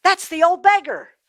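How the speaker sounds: high, loud burst of recognition; excited recognition. Acted. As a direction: suddenly place a familiar person. Rich man recognizes the beggar.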